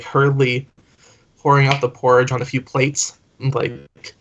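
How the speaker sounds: ordinary talk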